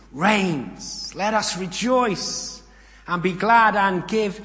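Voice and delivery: loud, high proclamation like a great crowd; shouted praise. Acted. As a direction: cry it out jubilantly, stretching the big words.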